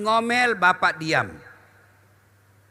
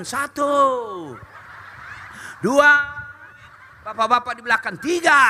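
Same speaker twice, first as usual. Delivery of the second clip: instructor count; 4 semitones higher than usual. Pretend. Counting an aerobics class.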